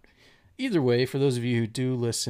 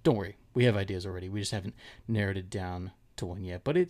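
normal talking voice

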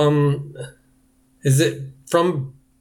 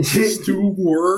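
Stuart voice demo; 5 semitones higher than usual. Shown as a demonstration. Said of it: "moving your mouth in spots it shouldn't be".

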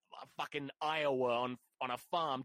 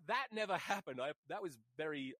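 ordinary talk, nothing marked

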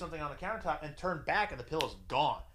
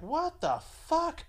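high, slow, drawn-out mock shock; imitating her stunned disbelief; shocked girlfriend; 14 semitones higher, much slower than usual. Girlfriend's shocked reaction voiced.

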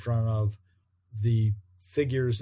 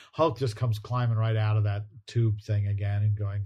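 ordinary delivery

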